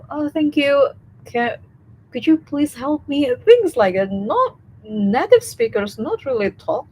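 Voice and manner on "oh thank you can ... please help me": sweet, extra-polite asking, pitched higher; overly polite request. Retold or imitated. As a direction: ask like a polite Indonesian. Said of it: "so usual and so normal to say".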